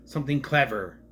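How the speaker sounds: put-on American accent, "clever" with an overdone R at the end; leaning hard on the R in "clever", American accent; British actor's American accent. Quoting the actor's stiff American accent line. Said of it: "a British person doing an American accent".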